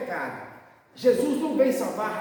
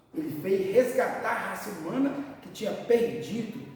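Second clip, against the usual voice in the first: normal talking voice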